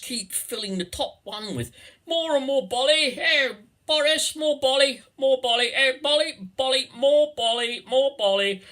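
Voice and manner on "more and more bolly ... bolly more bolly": loud, high braying toff calling for more; drunken posh toff. Pretend. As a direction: bray like a sozzled rich boy. Toff demanding champagne, Boris.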